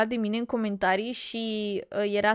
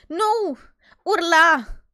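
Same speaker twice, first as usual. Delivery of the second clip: loud high outburst; emphatic; 5 semitones higher, 8 dB louder than usual. Real, not acted. Vlogger insisting the woman screamed, loud high outburst. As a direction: burst out with it. Indignant correction, she screamed.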